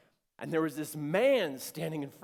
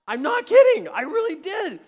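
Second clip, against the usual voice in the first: high, shouted comic protest; loud insistence; 17 dB louder than usual. Real, not acted. Speaker swearing to audience he did it, loud insistence. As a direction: burst out over the audience, voice leaping up.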